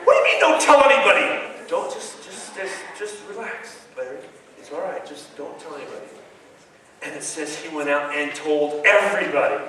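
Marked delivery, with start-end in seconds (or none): 0.1-1.3 fast, loud, laughing disbelief; excited protest. Retold or imitated. Larry to Jesus, fast and loud in laughing disbelief. 1.7-6.1 soft, hushed calming; soothing hush. Retold or imitated. Jesus calming excited Larry, soft and hushed.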